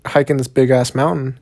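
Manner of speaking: ordinary talk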